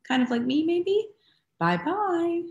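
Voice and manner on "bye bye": higher motherly goodbye; Mama Bear voice. Shown as a demonstration. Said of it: "what would a Mama Bear sound like".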